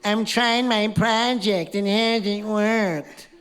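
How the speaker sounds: high, drawn-out whine; mock a whining complainer, slow and high; put-on whiny moan; whiny complaint.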